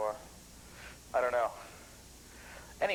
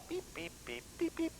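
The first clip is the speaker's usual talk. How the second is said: high, soft beeping like button tones; imitated beeps; 7 dB quieter than usual. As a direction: mimic the tone generator chirping high. Imitating the blue box tones.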